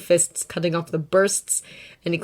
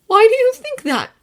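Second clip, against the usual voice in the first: much higher, trembling question; shaking voice; 9 dB louder than usual. A narrator's reading. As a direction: ask it with a shaky voice. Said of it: "voice shaking".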